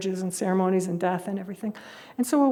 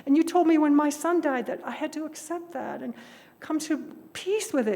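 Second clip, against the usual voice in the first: higher woman's voice, indignant; protesting woman; 6 semitones higher than usual. Retold or imitated. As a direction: remind the guru of his own words. Woman protests to her guru.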